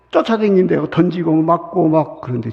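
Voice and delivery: normal talking voice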